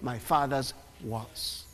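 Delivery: slow, deliberate solemn words; slow solemn delivery. Acted. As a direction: let each word land slowly. Preacher slowing Jesus' words.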